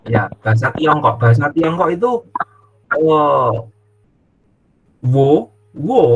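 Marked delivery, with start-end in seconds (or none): 5.0-6.0 "wo, wo" sung high and loud in different tones; "wo" said in contrasting Mandarin tones. Shown as a demonstration. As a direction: demo of Chinese tones on "wo". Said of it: "wo itu beda-beda".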